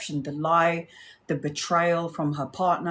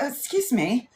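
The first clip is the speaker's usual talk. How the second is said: posh colleague quiet; 5 dB quieter than usual. Retold or imitated. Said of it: "She's gonna be like".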